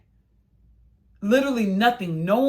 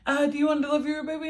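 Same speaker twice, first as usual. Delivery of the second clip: high, brisk mock nurse voice; a lightly voiced nurse hurrying her along, pitched up; imitating the nurse; 7 semitones higher, faster than usual. The nurse coaching her through the last push, in a high, quick imitation. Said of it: "So she was like".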